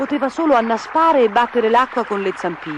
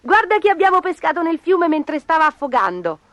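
put-on high, eager child voice; pitched-up storybook kids; excited children; 7 semitones higher, 3 dB louder than usual. A narrator's reading. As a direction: report it eagerly to Dad. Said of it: "dissero al loro babbo".

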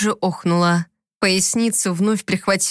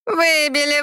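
Выбили sung out high and teasing; gloating mockery; 6 semitones higher than usual. A narrator's reading. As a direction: taunt with a high, smirking Выбили. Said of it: "ехидно ухмыляясь". (ехидно, высоко: «Выбили»)

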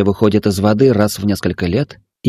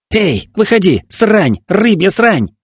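loud, high angry yelling; angry shout; 10 semitones higher, 4 dB louder, slower than usual. Acted. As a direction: bellow the insult down into the hole. Boys yell at hidden Ayka.

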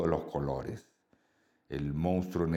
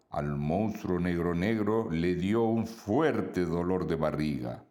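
ordinary delivery, nothing marked

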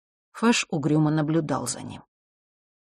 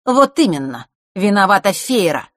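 higher, louder accusing agreement; emphatic agreement; 4 semitones higher, 6 dB louder than usual. A narrator's reading. Boy backs the girl blaming Feira, emphatic. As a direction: back her up forcefully. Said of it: "поддержал Марк".

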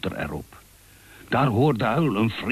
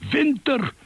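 calling out. Acted. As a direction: call out to him in alarm. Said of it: "riep Uil".

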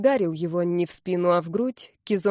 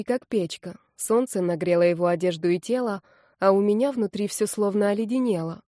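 usual speaking voice

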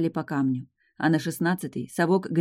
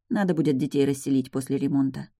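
usual speaking voice

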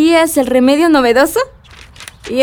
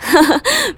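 short laugh; 5 semitones higher than usual. Real, not acted. Laughing at friend's idea.

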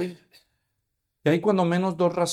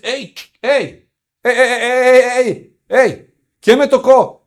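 higher and louder with each ey, slowing at the end; calling out, building; 7 semitones higher, 10 dB louder, slower than usual. Acted. Jesús forces the moving crowd to stop with a mounting call. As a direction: rein in the whole crowd, growing louder. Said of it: "él se para y dice".

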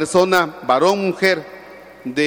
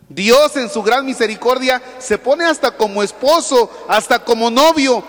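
loud, high preaching build; fervent preaching; 6 semitones higher, 4 dB louder than usual. Acted. Priest preaching God's love to the faithful, fervent and loud. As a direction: preach it out to the congregation. Preaching rises at full force.

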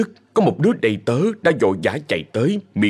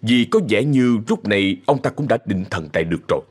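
ordinary delivery

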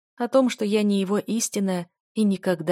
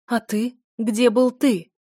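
distraught; 4 semitones higher, 5 dB louder, slower than usual. A narrator's reading. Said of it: "Не своим голосом произнесла я".

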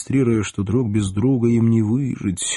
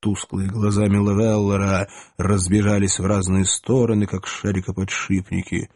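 usual speaking voice

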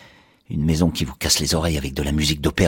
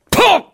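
coup de feu; 8 dB louder than usual. Acted. Coup de feu sur la télé.

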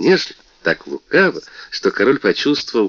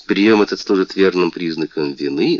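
ordinary delivery

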